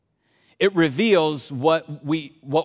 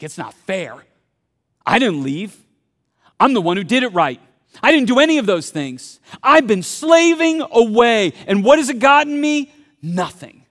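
louder, rising self-pitying complaint; sulking brother; 4 semitones higher, 6 dB louder than usual. Retold or imitated. Older brother complaining to his father, whining louder and louder. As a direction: whine that it isn't fair. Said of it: "It's like".

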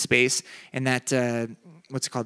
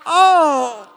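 high, loud gasp of dismay; a sudden horrified oh; shocked oh; 13 semitones higher, 9 dB louder than usual. Retold or imitated. Preacher reliving the sight of the lady's blood, a high horrified oh. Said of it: "I'm like".

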